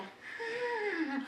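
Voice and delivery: very high Thai "Ah" through a laugh; mock Thai ending. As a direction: lilt up high, Thai style. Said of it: "really high nasal one".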